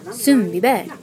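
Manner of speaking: slow, lilting Swedish station announcement; subway announcer. A narrator's reading. Student imitating the woman announcing subway stops, lilting Swedish announcer. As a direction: copy a recorded intercom voice's exact inflection. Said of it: "tried to repeat her exact inflection".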